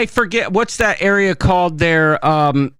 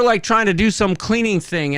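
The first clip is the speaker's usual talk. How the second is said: ordinary delivery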